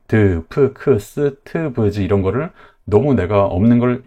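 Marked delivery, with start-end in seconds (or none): 0.1-2.0 "드, 프, 크, 스, 트, 브즈" each with a full 으 vowel; Konglish added vowels. Shown as a demonstration. Teacher showing a Konglish habit to avoid, separate over-voweled "드, 프, 크". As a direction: give every consonant its own syllable, as in "드, 프, 크". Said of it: "콩글리시로 너무 이렇게".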